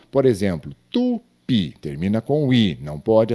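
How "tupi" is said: "tupi" split as tu, PI; stress on last syllable. Shown as a demonstration. Teacher gives an example of an oxytone, stress on last syllable. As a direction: lean on the final PI of "tupi". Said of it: "a sílaba tônica, na última".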